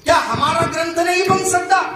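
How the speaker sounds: hammed-up royal boast; the emperor demanding his own scripture, high and boastful. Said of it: "बादशाह म्हणाला की".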